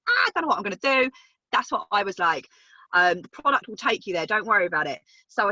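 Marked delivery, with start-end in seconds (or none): 0.1-1.1 mock panic. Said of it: "you're like".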